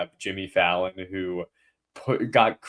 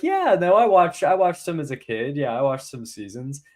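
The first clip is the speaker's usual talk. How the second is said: lightly imitated singer, higher-pitched; light mock-casual quote in a higher voice; 6 semitones higher, 3 dB louder than usual. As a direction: start high and loud, then ease down. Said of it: "And she was like".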